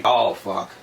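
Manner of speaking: imitating a guy admitting his burst of motivation was placebo; mock deflated mumble. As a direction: let the air out like the hype just died. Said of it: "they go back to that victim mentality".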